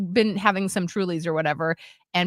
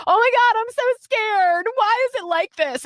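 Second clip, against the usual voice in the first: imitating scared girls; 13 semitones higher than usual. Girls in a dark theater, mock scared whine. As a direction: squeal it like a dramatic kid.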